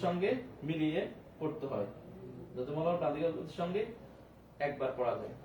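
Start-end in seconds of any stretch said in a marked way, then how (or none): none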